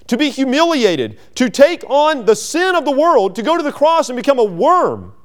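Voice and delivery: high, loud fervent preaching; climbing pulpit intensity. Acted. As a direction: preach it out with full fire. Preacher rises to full force.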